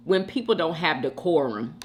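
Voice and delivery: normal talking voice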